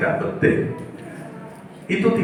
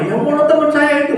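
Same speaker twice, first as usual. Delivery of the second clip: put-on higher, aggrieved young man; student's voice. Preacher voices his offended student answering him, higher and aggrieved.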